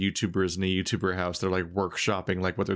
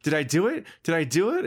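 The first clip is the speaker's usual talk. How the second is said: put-on eager child voice; 7 semitones higher than usual. Game voiced as a hopeful child.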